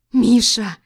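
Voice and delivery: wife pleading with husband; desperate cry. Acted. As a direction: call out in despair. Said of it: "с отчаянием".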